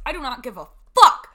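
emphatic. Real, not acted. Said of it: "let me speak on this".

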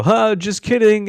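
high, drawn-out imitation of prankster parents; mock playful reveal. Parents revealing a prank to their kids, mock playful. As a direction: stretch it out high, mocking the parents.

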